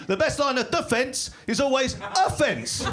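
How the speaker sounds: high, slow mock-triumphant delivery; hammed-up gloat; gloating taunt. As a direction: draw out the pun in a high taunt. Gloating pun at crashed rider.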